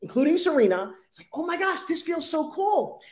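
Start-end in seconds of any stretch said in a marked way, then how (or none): 1.3-3.0 delighted customer. Retold or imitated. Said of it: "is like".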